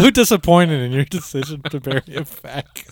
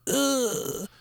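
disgusted ugh; 12 semitones higher, 6 dB quieter than usual. Retold or imitated. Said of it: "I was like".

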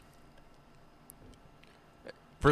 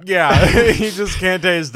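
loud, high burst through a laugh; 6 semitones higher, 10 dB louder, slower than usual. Real, not acted. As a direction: crack up while talking. Laughing at the hot wings story.

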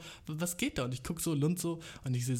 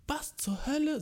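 mock outraged shriek; 13 semitones higher, slower than usual. Retelling a plane passenger yelling at someone behind her, mock outraged shriek. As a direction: squawk it like someone just slapped. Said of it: "sie schrie so, als hätte man ihr eine Backpfeife gegeben".

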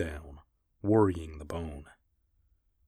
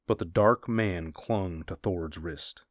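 ordinary delivery, nothing marked

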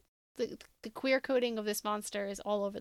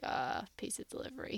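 bored, deadpan mumble; imitating an unimpressed delivery guy, half voiced; flat, couldn't-care-less pizza guy; unfazed; 8 dB quieter than usual. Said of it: "he's unfazed".

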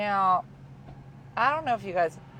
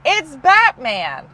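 blunt retort; 8 semitones higher, 14 dB louder, much slower than usual. Retold or imitated. Wife's blunt retold answer.

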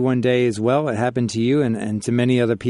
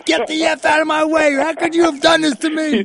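mock high-pitched yell; hammed-up outburst, pitched way up; yelling like a football player. Host imagining the wife in childbirth, mock yelling like a football player. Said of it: "she was yelling like a football player".

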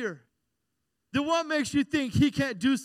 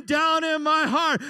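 higher, louder, slow chanted answer; kids' shout back; 5 semitones higher, 6 dB louder than usual. Retold or imitated. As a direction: yell it back like a child in class. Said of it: "we would scream".

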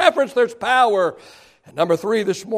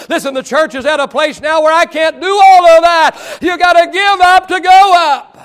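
loud, high shouted refrain; preaching at full force; 6 semitones higher, 8 dB louder than usual. Real, not acted. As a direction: hammer the slogan home to the crowd.

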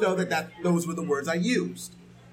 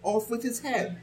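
higher, imperious royal command; Red Queen; 5 semitones higher, 3 dB quieter than usual. Retold or imitated. Reviewer quotes the Red Queen with imperious command. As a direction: order the beheading like a haughty queen. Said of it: "as the Red Queen would say".

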